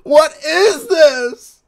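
very high, slow, half-crying shriek; shrieking disbelief. Real, not acted. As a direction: wail it out in disbelief. Reacting to a spiced-up clip.

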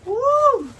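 high, loud whoo; excited whoop. Real, not acted. Can't take it before opening, excited whoop.

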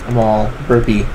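normal talking voice

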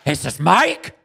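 loud, high, thrilled recognition; excited call. Real, not acted. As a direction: call out in delighted surprise. Spots friend on screen.

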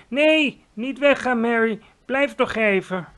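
high, loud, pleading queen; sudden high cry of don't go; urgent plea. Acted. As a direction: call her back before she leaves. Queen begs maid to stay.

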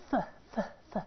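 "ف" as a voiceless TH puff; tongue out on the teeth, just blow "ف"; isolated, very soft TH for "ف"; TH sound alone. Shown as a demonstration. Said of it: "تي اتش لازم اعمل".

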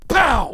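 sudden loud playful pow; shouted comic smack sound; mock slap pow. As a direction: pop it out like a comic punch.

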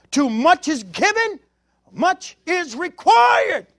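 slow, rising shouted proclamation; full-force preacher's cry; preaching climax. Acted. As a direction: stretch it out and shout the end.

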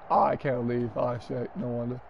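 ordinary talk

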